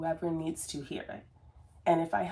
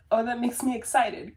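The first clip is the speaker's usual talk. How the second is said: mock excited inner voice; 6 semitones higher, 6 dB louder than usual. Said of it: "like, just".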